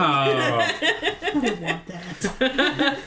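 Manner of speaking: drawn-out creature voice, very slow; slow, dragging animal voice; slow goat voice. Acted. Goat character speaking slowly.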